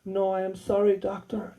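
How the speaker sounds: Russian stripper rejection. Retold or imitated. Stripper rejecting her chiropractor, soft Russian accent. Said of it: "brutal and rejected me".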